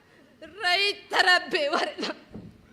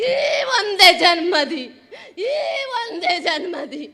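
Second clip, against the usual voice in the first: loud, sobbing patriotic outburst; crying patriotic cry; 8 dB louder than usual. Acted. Woman vowing to her country over the abandoned baby, crying loudly. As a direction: shout the vows through tears. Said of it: "ಎಲ್ಲರ ಕಣ್ಣಂಚಿನಲ್ಲಿ ನೀರು ತರಿಸಿ".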